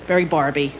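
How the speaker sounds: ordinary talk, nothing marked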